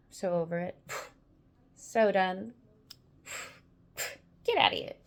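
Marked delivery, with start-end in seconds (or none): 4.4-4.9 much lower, scoffing; low dismissive. Real, not acted.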